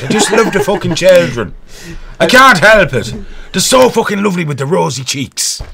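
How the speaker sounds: high, hammed-up Irish lilt; Irish accent. Host as an Irish priest, mock-gushing Irish accent. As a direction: gush in a comic Irish accent. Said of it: "Tom Cruise's Irish accent".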